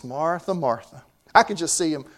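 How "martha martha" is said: soft, compassionate call of her name; Jesus gently calling Martha by name, compassionate. Retold or imitated. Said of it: "Jesus is expressing compassion when he says this".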